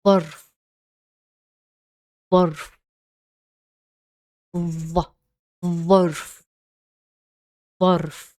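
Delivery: ظرف with the tongue between the teeth; Arabic word demo. Shown as a demonstration. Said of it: "here are some examples".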